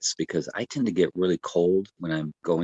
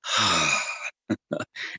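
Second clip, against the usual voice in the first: surrendering sigh. Shown as a demonstration. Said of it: "it's just a big surrender".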